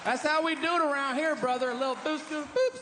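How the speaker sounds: high, whooping Texan bro, pitched well up; a hammed-up Texan bro crying out with pride; mock Texan whoop. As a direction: yell it like a proud good old boy. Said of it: "I think they're like".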